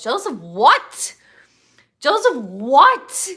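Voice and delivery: jealous of what, really?; incredulous. Real, not acted. Incredulous retort, loud.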